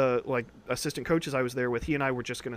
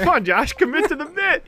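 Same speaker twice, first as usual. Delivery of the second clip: louder, laughing teasing push; 7 dB louder than usual. Real, not acted. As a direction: egg him on through laughter.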